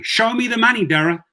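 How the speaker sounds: high, emphatic "Show me the money"; mock demand. Driver taunting the Uber CEO on Twitter, high and punchy. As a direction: demand the cash like a movie line.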